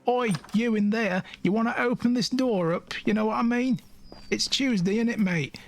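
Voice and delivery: high, rough cockney geezer calling through a door; mock cockney bloke banging on the door; cockney accent. Player mocking how a cockney stranger would call the kid out. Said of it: "some strange British voice".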